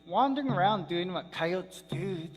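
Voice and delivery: ordinary delivery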